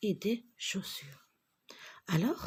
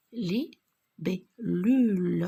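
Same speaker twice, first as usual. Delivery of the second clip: slow syllables; 6 dB louder, slower than usual. Shown as a demonstration. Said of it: "Regardez, deux L".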